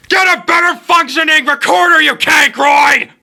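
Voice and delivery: jump-scare scream into his ear; sudden shout. Acted. Sudden shout after luring close.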